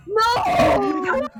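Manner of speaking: anguished no. Acted. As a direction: cry out in mock despair. Mock anguished outburst.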